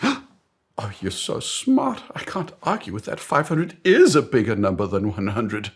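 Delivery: high-pitched mock admiration; gushing awe. Colleague won over by boss's logic, hammed-up high awe. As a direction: gush as if dazzled by genius.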